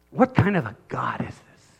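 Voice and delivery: whispered in wonder; awed whisper. Real, not acted. Preacher marveling at God in a whisper. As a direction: breathe the question in awe. Awe at God's power.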